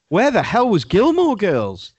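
high-pitched outraged demand; indignant. Real, not acted. Viewer outraged at a show left off the list, indignant.

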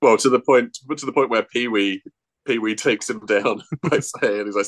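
ordinary talk, nothing marked

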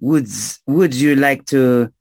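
put-on French-accented questioning. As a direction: offer directions with a French accent. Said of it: "stranger with a French accent".

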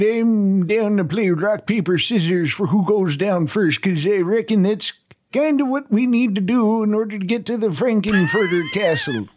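ordinary talk, nothing marked

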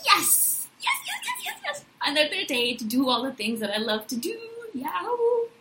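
squealing yeses, high and laughing; gleeful morning cheer. Retold or imitated. Waking up thrilled for the day, giddy squeals. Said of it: "I'm thinking to myself".

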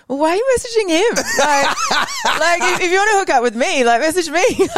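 high-pitched, exasperated protest; jokey wounded outrage; mock indignation. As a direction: play up the indignant complaint, pitch way up. Acts out indignant retort to messages.

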